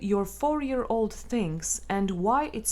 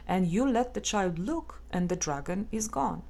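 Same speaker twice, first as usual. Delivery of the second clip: ordinary talk, nothing marked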